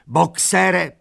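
high, loud call; old mare calling after the cart; shout. A narrator's reading. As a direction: call out his name. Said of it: "křičela".

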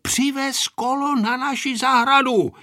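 put-on high-pitched child calling across the yard. A narrator's reading. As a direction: call the order out to her. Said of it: "zavolal Pepíček na Anešku".

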